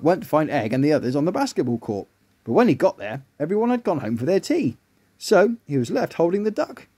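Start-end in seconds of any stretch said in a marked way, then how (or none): none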